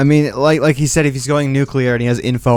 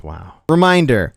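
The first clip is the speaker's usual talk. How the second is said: usual speaking voice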